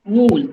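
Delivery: Wool with a long oo and a clear l; dictionary pronunciation. Shown as a demonstration. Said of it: "It is pronounced as wool or wool?".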